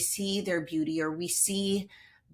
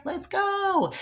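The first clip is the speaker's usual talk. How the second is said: a high, bright burst to launch the show; excited kickoff; 13 semitones higher than usual. Real, not acted. Host kicking off the podcast episode, excited. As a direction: pop the energy up as the show starts.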